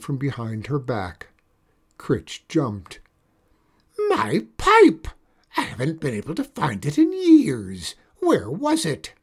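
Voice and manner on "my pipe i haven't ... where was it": very high and loud, overjoyed; delighted shout. Acted. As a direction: cry out at the long-lost pipe. Witch finds lost pipe.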